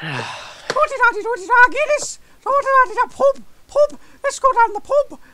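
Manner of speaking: very high, loud mock-Irish squeal; high-pitched Irish impression. Showing off a silly Irish impression, high-pitched squeal. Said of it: "me making really high-pitched tooty-tatty-too noises".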